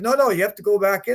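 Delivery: fast insistent corner man; corner man insisting. Retold or imitated. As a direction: push him back into the ring. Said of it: "he goes".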